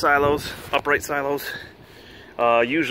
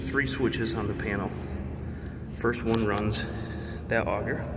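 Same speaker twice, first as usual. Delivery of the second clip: inside a silo; 9 dB quieter than usual. Real, not acted.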